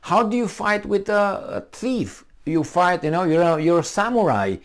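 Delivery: high, earnest pupil voice; samurai student. Retold or imitated. Student asking his master, earnest high voice. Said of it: "was asking his master".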